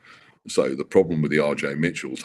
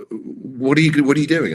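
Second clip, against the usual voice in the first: higher and louder, in disbelief; alarmed father; 9 semitones higher, 7 dB louder than usual. Retold or imitated. Father questioning his son before the meeting, loud and high. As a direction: exclaim it like a father who can't believe his son. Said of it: "he said".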